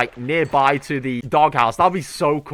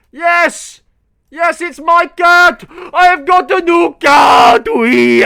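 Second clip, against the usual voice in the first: much higher, louder, slow victory cheer; triumphant shout; 12 semitones higher, 11 dB louder, much slower than usual. Real, not acted. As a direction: cheer the new cat. Tames new cat, celebrates.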